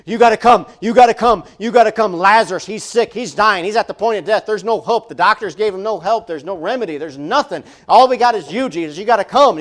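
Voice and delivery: loud, high, urgent pleading; breathless excitement. Retold or imitated. Messenger urging Jesus to come, loud and frantic. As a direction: burst in begging him to hurry. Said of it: "that kind of excitement where it's just like".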